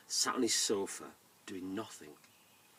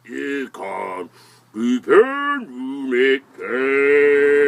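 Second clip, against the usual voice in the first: very slow, creaking, stretched words; odd, long-held tree character voice; 3 semitones higher, 13 dB louder, much slower than usual. Acted. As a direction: draw it out as a talking tree, swelling loud. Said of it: "said in a very tree-like".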